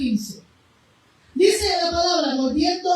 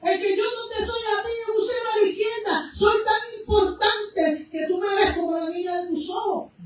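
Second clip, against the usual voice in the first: impassioned preaching; 6 semitones higher than usual. Acted. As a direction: preach it out with rising fervor. Preaching at full force. (predicación fervorosa)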